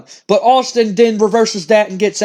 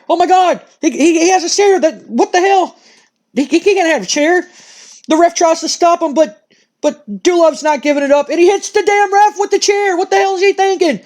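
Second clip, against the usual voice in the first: shocked excitement; 6 semitones higher than usual. Real, not acted. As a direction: react like you can't believe your eyes. Commentator sees chair attack.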